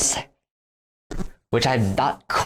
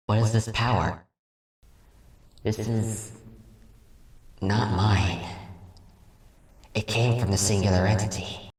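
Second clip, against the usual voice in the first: a dying spirit clinging on; weakened earth spirit; 2 semitones lower, 9 dB quieter, slower than usual. Acted. The spirit, defeated, fading away.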